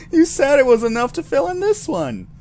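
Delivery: whiny protest. Acted. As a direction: complain like a cheated customer. Player protests to the clerk.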